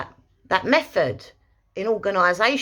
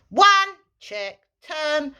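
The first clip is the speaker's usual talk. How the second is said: much higher, quick and short; sharp cue; 7 semitones higher, much slower than usual. Shown as a demonstration. Trainer demonstrating the command sequence. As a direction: clip the commands out. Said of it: "all you're doing is going".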